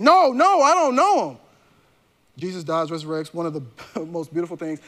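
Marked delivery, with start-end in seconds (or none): 0.0-1.4 higher, louder flustered denial; agitated denial. Retold or imitated. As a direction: push them off, getting rattled. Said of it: "And now he starts getting".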